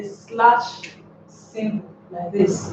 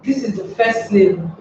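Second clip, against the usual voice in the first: ordinary delivery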